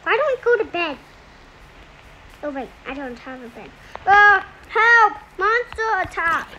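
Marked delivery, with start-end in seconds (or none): 4.0-6.5 playful loud cry for help; fluttering cries for help. Pretend. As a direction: call for help, half in game fun. Monsters attack in game.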